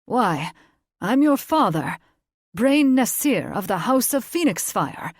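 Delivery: a preening fae noble presenting his title; proud fae lord. A narrator's reading. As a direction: fae father introduces himself to daughter, proudly. Fae father announces himself.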